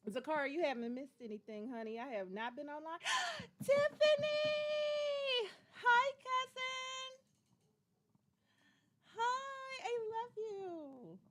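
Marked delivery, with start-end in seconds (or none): 3.0-3.6 sharp, much higher gasp of joy; delighted gasp. Real, not acted. Spotting cousin in the chat. 3.6-11.2 much higher, louder, slow drawn-out calls; thrilled greeting. Real, not acted. Greeting cousin in the chat.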